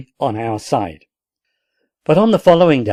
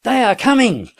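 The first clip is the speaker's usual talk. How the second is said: very high, piercing cry; piercing shout; 11 semitones higher than usual. A narrator's reading. Sergeant calling down from the garret, piercing shout. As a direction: shriek the good news from above. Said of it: "uttered a piercing shout".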